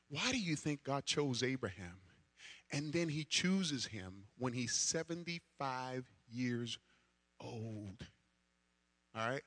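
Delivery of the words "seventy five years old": put-on slow down and drag out "old"; emphasis on old. Shown as a demonstration. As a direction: set "old" apart after a pause, low and soft. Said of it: "Emphasis on old".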